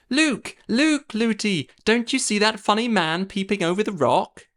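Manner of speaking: put-on high, chattering young girl; little princess voice. A narrator's reading. Princess calls to her running nurse, high and chattering.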